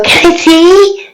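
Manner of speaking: put-on high, slow child's plea; little girl voice. Little girl running to the hurt ogre, high childlike voice. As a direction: ask like an eager little kid. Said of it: "I'm gonna run over and go".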